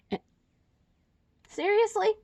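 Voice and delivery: high-pitched incredulous questions; exasperated disbelief. Real, not acted. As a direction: protest an interruption, pitch jumping up. Interrupted, reacts to someone present.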